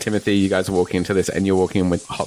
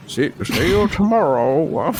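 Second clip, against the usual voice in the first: put-on slow, high old man farewell; 6 semitones higher than usual. GM redoes the old man's goodbye, slow and high. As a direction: draw it out as the doddering old man. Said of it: "Oh, no, sorry".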